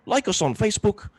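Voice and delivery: high-pitched mock British plug; British accent. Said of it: "learn how to do British accents".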